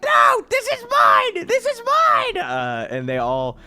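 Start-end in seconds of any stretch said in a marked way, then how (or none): 0.0-2.4 put-on very high, loud, slow child's yell; a boy guarding his food from the dogs; shrill frantic shout; kid shouting. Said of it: "he's like".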